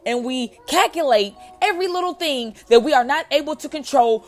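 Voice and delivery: fired-up sermon to a friend; preaching. Acted. Preaching to viewers.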